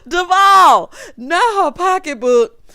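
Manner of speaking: loud, slow, laughing disbelief; laughing shout. Real, not acted. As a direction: yell it through the laughter. Cracking up at the line.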